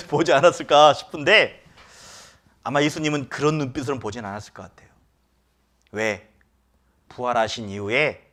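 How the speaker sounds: usual speaking voice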